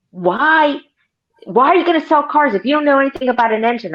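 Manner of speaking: imitating an incredulous salesman, high and fast; mock exasperated challenge. Veteran salesman challenging clueless new hire, mock exasperated.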